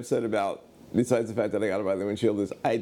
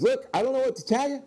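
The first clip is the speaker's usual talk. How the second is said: high-pitched, exasperated excuse; exasperated shop owner. Retold or imitated. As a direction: plead helplessly, voice pitched way up. Acting out the flustered shop owner.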